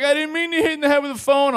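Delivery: high, loud whine of defence; mimicked protesting yell. Girlfriend defends hitting boyfriend, mimicked high protest. Woman's protest in acted quarrel.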